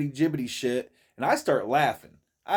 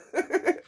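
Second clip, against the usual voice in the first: laughing. Real, not acted. Laughing at church story.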